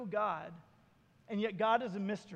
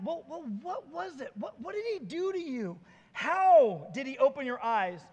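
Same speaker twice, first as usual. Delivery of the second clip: acted interrogation; 2 semitones higher, 4 dB louder than usual. A narrator's reading. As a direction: press him, stretching the key word. Pharisees questioning the healed man again.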